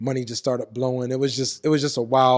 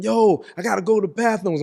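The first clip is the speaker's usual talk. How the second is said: mock holler, higher-pitched; raised-voice reenactment of yelling; retold scream; 7 semitones higher, 4 dB louder than usual. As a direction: call out as if to guards from a cell. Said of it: "So now I'm screaming".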